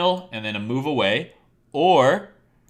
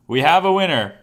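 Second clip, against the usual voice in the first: louder, booming prize-winner call; game show announcer; 4 semitones higher, 8 dB louder than usual. Acted. Teacher announces to the stream class who got it right, booming game-show call. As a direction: belt it out like a quiz host crowning the champ.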